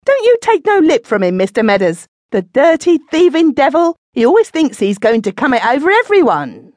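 put-on drunken woman screaming at Ben. A narrator's reading. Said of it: "She suddenly screamed out".

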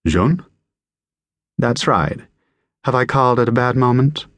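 lifted American caller's voice, questioning; American accent. A narrator's reading. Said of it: "A woman with an American accent replied".

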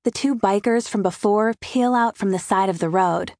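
plain reading voice

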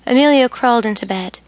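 usual speaking voice

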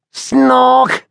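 Henry imitating Billy's snore, a loud snort; mock snore. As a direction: snort like a snarling fisher cat. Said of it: "You sounded like a snarling fisher cat".

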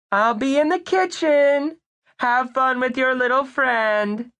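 high, sweet motherly voice; sugary doting mother; doting mom. Acted. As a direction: coo at your little boy.

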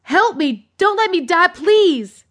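high, panicked pleading; desperate begging. A narrator's reading. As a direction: beg for your life. Dangling man begs for his life.

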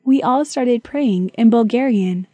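ordinary delivery

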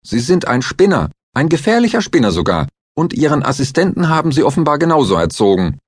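high, loud and fast accusation; heated insult. A narrator's reading. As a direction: fire the insults off quickly. Klinger insults Stahl heatedly.

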